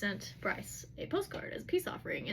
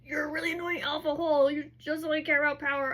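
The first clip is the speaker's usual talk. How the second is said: higher, louder mock retort; getting upset; 5 semitones higher, 6 dB louder than usual. Bryce hitting back at Hunt's scolding, upset. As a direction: act out an annoyed comeback, voice lifted. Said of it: "getting upset".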